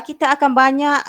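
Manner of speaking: ordinary talk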